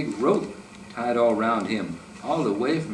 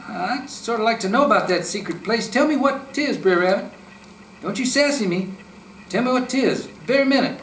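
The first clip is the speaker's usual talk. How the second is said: much higher and louder, bossy; demanding fox; 11 semitones higher, 3 dB louder than usual. A narrator's reading. Fox to the rabbit, a high bossy demand. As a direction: demand it, don't you sassy me.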